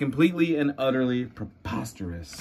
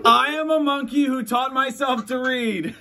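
silly high voice; 9 semitones higher than usual. Acted. Reading silly monkey lines.

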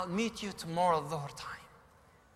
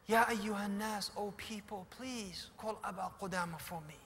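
put-on faint, softer, dying boy calling out; a wounded boy barely able to call, much softer; weak voice; 8 dB quieter than usual. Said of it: "a weak voice came from behind".

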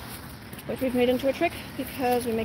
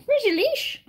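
high dog-talk voice; 9 semitones higher than usual. Real, not acted. Owner calling to puppy.

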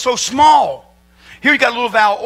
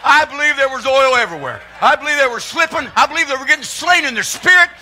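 higher, rising fervour; excited preaching; 6 semitones higher than usual. Real, not acted. Preacher telling of the widow's overflowing oil, excited rising shout. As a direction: build the joy up into a shout.

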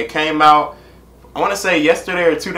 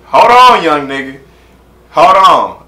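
calling a halt; 3 semitones higher, 8 dB louder, slower than usual. Real, not acted. Reactor halts the song to respond.

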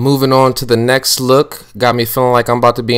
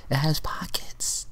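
breathy secret whisper of "It has pockets"; 5 semitones higher, 11 dB quieter than usual. Acted. Host whispers the pockets detail to the viewers. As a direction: lean in and share it like a secret.

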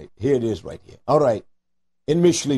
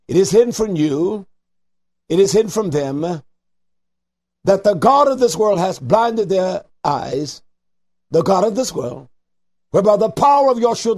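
preaching at full force; 3 semitones higher than usual. Acted. Radio preacher builds to full force.

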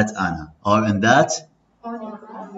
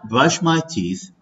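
slow, higher, stress on my; slow model phrase; 4 semitones higher, slower than usual. Shown as a demonstration. Teacher leading a repeat-after-me drill, slow. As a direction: model it slowly for the class. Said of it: "read with me".

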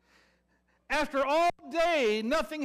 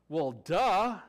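ordinary talk

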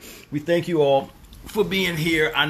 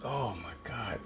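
ordinary talk, nothing marked

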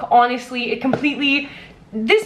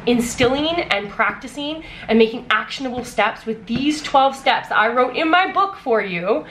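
ordinary talk

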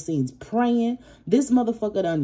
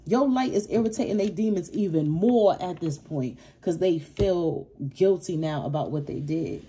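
normal talking voice

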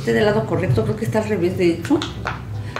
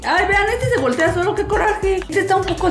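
annoyed excited squeal; 8 semitones higher than usual. Real, not acted. Toy flips by itself.

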